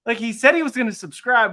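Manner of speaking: usual speaking voice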